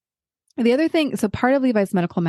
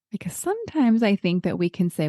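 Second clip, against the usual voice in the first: usual speaking voice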